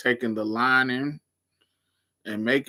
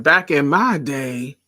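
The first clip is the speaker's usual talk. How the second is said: put-on slow, higher, loud old auntie lecturing; old lady voice; 5 semitones higher, 5 dB louder, slower than usual. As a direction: scold like a crotchety old aunt. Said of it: "I live for saying".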